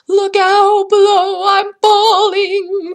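long, high, wailing warning call; falling voice calling from high above; crying out. A narrator's reading. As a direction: holler it loud, stretching each word. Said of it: "cried a voice".

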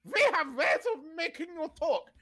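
mock German accent, pitched high. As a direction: imitate a comical German interrogator's accent. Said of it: "It was done in a German accent".

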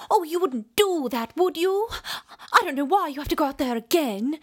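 high, pleading mock-British heroine; mock British accent. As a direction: plead sweetly in an exaggerated British accent, a bit fast at the end. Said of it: "Like, it's so British".

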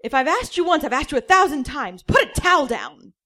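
high, loud exasperated nagging; a hurt father scolding again; loud, high, wounded nag; stung nagging dad. Acted. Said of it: "[name] sounded stung".

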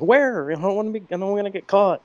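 high, quick alarmed driver; mock panic.

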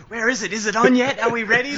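high-pitched pestering questions; mock impatient viewers. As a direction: imitate restless viewers, voice pitched right up. Mimicking impatient viewers waiting.

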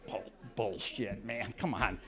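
low, slow scoffing cousin; a lazy low mock scoff. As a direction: brush it off like the unbothered cousin. Cousin dismissing him, low.

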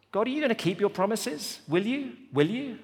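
high, pressing questions to God; a doubting psalmist challenging God, high and pressing; pleading doubt. Acted.

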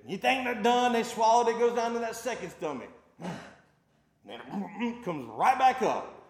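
loud, high, rising sermon build-up; building preacher voice. Acted. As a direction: climb toward the punchline of the cud story. Retelling the cud, louder.

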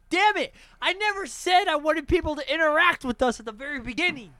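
high, loud, squawking tirade; mock outrage. Host complains to co-host about viewer interaction, mock outraged yell. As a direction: protest in a high exasperated yell.

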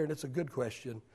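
usual speaking voice

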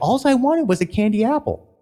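high whiny complaint; whiny complainer voice. Retold or imitated. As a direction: whine like an unhappy guest. Mimicking unhappy meeting attendee.

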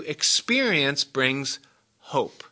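slow, drawn-out teaching emphasis. Shown as a demonstration. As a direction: lean on each word to teach it. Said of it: "preacher drawing out key teaching".

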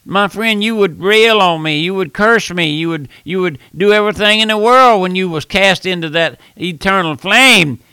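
ordinary talk, nothing marked

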